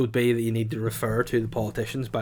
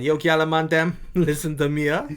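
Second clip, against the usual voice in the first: put-on Jamaican reggae voice, higher and louder; Jamaican patois accent; 5 semitones higher, 4 dB louder than usual. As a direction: open the speech like a reggae MC. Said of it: "you should open up by saying".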